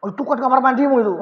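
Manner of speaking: fast teasing reproach; quick scolding. Acted. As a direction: toss it off as a mock rebuke. Preacher voices an irritated reaction.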